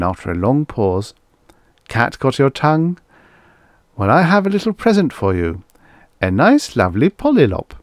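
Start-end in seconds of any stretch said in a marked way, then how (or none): 1.9-7.7 higher-pitched, eager sales patter; coaxing robot vendor. Acted. Robot offers a youngster a present, coaxing. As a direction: offer the present with big, lifted emphasis.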